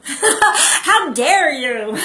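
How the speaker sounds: loud, slow, drawn-out how dare you with a laugh; mock rage, laughing. Narcissist raging at someone, hammed-up mock rage with laughter. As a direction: ham up the outrage through laughter. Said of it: "totally mad".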